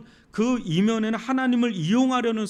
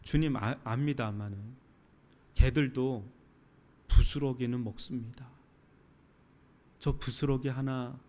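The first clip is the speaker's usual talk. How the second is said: low, soft, humble begging; humble plea; 6 semitones lower, 5 dB quieter than usual. Retold or imitated. Syrophoenician woman humbly begging Jesus, low and soft. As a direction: beg quietly for a crumb of mercy. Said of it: "궁유를 구하는 그 기도".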